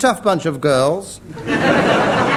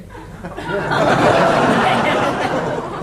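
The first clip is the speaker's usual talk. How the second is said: breathy laugh; 4 semitones higher than usual. Real, not acted. Laughs at the underground joke.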